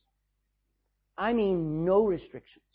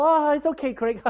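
mock breezy God; 9 semitones higher, 6 dB louder than usual. God voiced brushing sin off.

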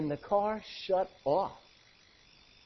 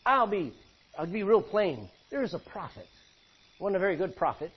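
ordinary delivery

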